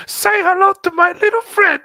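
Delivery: high, loud, hammed-up gangster quote; Al Pacino impression. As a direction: belt it out like a movie gangster. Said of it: "there's the Al Pacino one".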